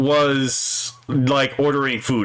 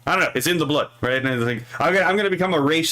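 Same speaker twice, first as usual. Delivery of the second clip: normal talking voice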